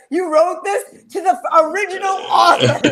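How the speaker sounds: high, laughing mock Scottish outrage; retold Scottish indignation, pitched well up; irate Scottish. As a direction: imitate an incredulous angry Scot. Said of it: "with a Scottish irate".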